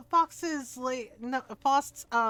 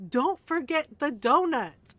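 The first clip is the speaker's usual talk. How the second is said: slow, emphatic exasperated reminder; retold exasperation, drawn out word by word; 4 dB louder, slower than usual. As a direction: spell it out with weary impatience. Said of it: "exasperated with me".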